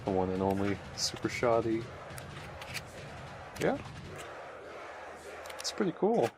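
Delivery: ordinary talk, nothing marked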